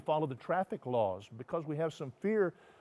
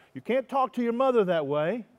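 higher and louder stern rebuke; parental scolding; 8 semitones higher, 8 dB louder than usual. Acted. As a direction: scold a mouthy child.

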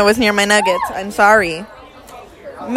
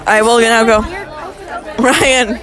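ordinary delivery

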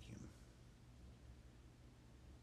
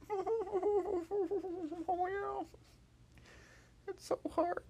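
high, louder sheepish voice; sheepish Hop; 9 dB louder, much slower than usual. Acted. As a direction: admit it with a sheepish giggle. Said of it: "And Hop went".

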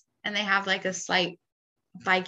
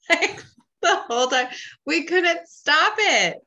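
exasperated amusement; 9 semitones higher, 5 dB louder than usual. Real, not acted. Wife confessing their accent habit, high amused exclamation.

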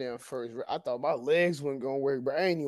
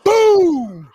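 loud, high shouted boom; sudden booming impact noise; imitated crash boom; 13 dB louder than usual. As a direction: belt out a crash sound effect. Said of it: "Just like that".

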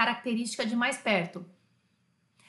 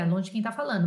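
ordinary talk

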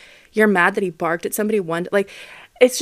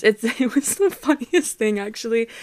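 laughing; 4 semitones higher than usual. Real, not acted. Laughs at funny vet memory.

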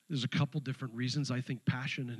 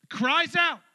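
even louder, higher punch on cries out; emphatic repeat; 10 dB louder than usual. Acted. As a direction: hammer the phrase home. Repeated phrase, louder still.

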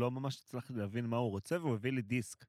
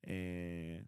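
ordinary delivery

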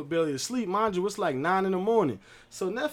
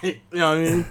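ordinary talk

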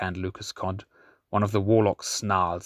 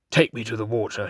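commanding; faster than usual. Acted. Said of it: "he commanded".

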